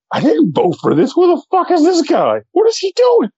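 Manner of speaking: high, outraged "what is he doing"; mock outraged disbelief. Voicing a regretful voter, high and outraged. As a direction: pitch it way up in disbelief. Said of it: "why would you not want to go".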